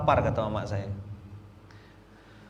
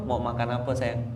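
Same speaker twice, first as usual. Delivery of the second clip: sweet coaxing; 4 semitones lower, 5 dB quieter than usual. Retold or imitated. Husband sweetly asking wife.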